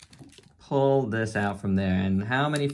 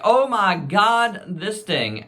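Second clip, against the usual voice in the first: amazed; 9 semitones higher, 6 dB louder, slower than usual. Retold or imitated. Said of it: "I was like".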